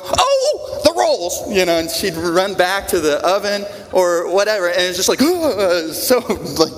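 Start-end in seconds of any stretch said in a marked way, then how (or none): 0.0-1.5 sudden high gasp of remembering; startled grandma. Retold or imitated. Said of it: "my grandma would go". 5.2-5.9 sharp little startled gasp; mock gasp. Grandma remembering the rolls.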